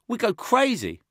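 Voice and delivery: put-on high, loud tough little boy; a scrappy kid who feels a pang; little kid Josh. Little Josh mourning the taken boy, high kid voice. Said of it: "scoffed Josh".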